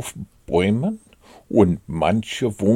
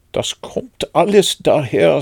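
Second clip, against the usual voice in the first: higher, louder irritated complaint; annoyed; 8 semitones higher, 5 dB louder than usual. A narrator's reading. Stuck Pooh blames the small front door, annoyed. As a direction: protest crossly, pitched up. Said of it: "sagte Puh verärgert".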